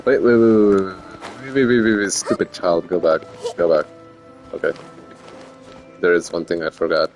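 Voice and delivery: ordinary talk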